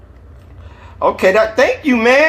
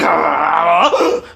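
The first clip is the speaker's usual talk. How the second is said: 1 dog growl; 2 semitones higher than usual. A narrator's reading. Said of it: "low dog's growl".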